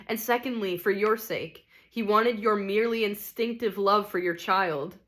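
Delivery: usual speaking voice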